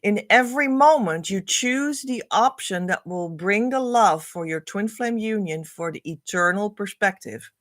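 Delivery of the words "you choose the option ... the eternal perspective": ordinary talk, nothing marked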